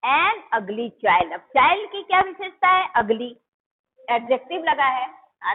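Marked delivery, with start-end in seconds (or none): 0.1-1.3 put-on an ugly child said very slowly word by word; slow clear example. Shown as a demonstration. Teacher dictating an example to students, slow and clear. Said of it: "जैसे".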